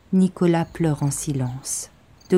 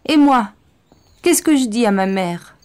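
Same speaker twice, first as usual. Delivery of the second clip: desperate protest; 5 semitones higher, 5 dB louder than usual. Acted. Bastien pleads, loud.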